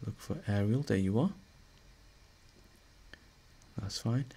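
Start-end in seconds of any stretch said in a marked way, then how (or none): none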